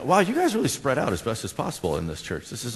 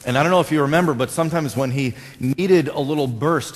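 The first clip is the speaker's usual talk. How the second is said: ordinary talk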